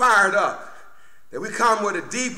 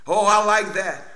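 usual speaking voice

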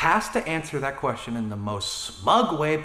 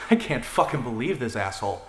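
ordinary talk

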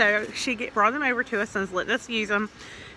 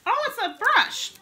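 surprised; 13 semitones higher, 5 dB louder, slower than usual. Real, not acted. Opening advent surprise.

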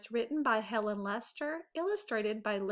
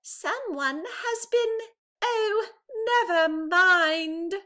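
much higher, slow, louder little bear voice; Baby Bear giving up; 10 semitones higher, 7 dB louder, slower than usual. A narrator's reading. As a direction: trail off into a sulky never mind. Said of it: "Baby Bear said".

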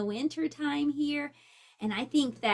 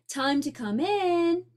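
higher, slow called-out reminder; calling; 5 semitones higher, 4 dB louder than usual. Acted. Mother calling her son inside, called out. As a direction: call it across the yard, unhurried. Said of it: "called his mother".

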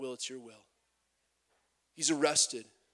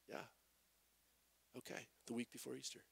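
much softer and fast, a bored brush-off; unenthused shrug; 5 semitones lower, 18 dB quieter, faster than usual. Retold or imitated. Congregation shrugging off Palm Sunday, soft and fast. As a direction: toss it off like it's nothing special. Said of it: "we were like".

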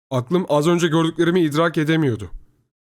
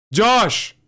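high, loud call from afar; father shouting; 9 semitones higher, 6 dB louder than usual. A narrator's reading. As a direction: yell a name out of the darkness. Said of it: "Babam karanlıktan tekrar bağırdı". (karanlıktan bağırarak seslenme)

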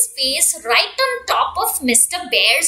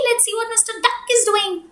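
plain reading voice

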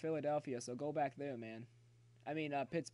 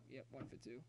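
ordinary delivery

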